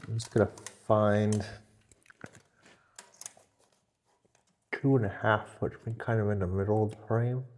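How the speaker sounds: talking around a marker held in the teeth; muffled words with a pen between the lips; marker in mouth. Real, not acted. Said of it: "I'm talking with a marker in my mouth".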